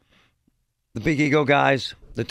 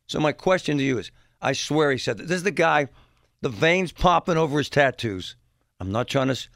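normal talking voice

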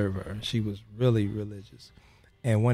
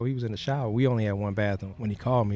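ordinary talk, nothing marked

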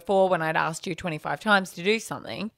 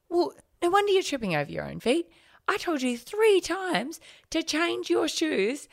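sweetly nice scolding; 12 semitones higher than usual. Retold or imitated. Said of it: "she was so nicely saying it to him".

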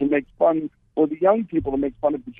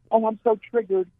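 lightly mocking, higher-pitched teen quote; a higher voice, feigning offense as a joke; sarcastic; 6 semitones higher than usual. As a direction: imitate a daughter's ironic mock distress. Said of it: "you mean she says it sarcastically?".

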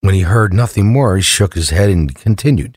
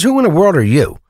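higher-pitched acted demand; lightly voiced baffled burglar; 9 semitones higher, 3 dB louder than usual. Joke teller as burglar asking the parrot, mildly baffled high voice. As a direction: raise the pitch in mild mock disbelief.

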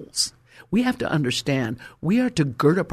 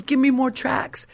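higher, eager plea; eager young woman; 10 semitones higher than usual. Retold or imitated. Young woman to the preacher, a higher eager plea. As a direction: ask for more like she can't wait. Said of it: "She goes".

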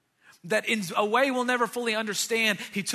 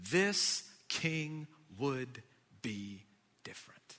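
slow, breathy whisper of the line; whispered refrain; 6 semitones lower, 5 dB quieter, slower than usual. Acted. Pastor repeating the refrain to the church, whispered. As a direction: hush it like a secret.

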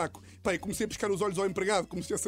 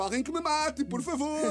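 mock despair; 6 semitones higher, much slower than usual. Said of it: "E eu estou tipo".

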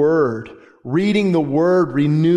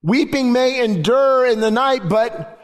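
high, ringing preacher's cry; impassioned preaching; preaching at full force; 8 semitones higher than usual. Acted. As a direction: lift the scripture up with fervor. Preaching climax, high and loud.

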